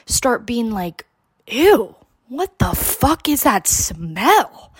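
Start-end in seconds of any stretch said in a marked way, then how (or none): 1.5-4.7 high, slow and grossed out; disgusted. Retold or imitated. Teen in the car reacting to a foul smell, disgusted. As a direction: recoil at the stench, drawn out.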